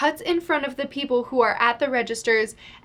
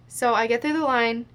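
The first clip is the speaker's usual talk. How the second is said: ordinary talk, nothing marked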